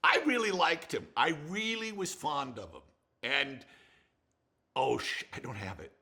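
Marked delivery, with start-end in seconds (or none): 0.0-2.8 tender whisper. Real, not acted. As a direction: breathe it with affection. Fond remembrance.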